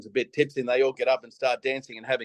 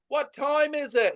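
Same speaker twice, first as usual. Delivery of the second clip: high, drawn-out mock call; retold yelling out; 12 semitones higher, slower than usual. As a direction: call it out slowly like a kid, without real volume. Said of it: "And I'd yell out".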